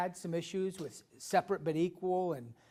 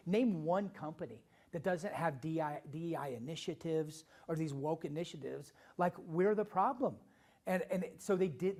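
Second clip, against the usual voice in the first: usual speaking voice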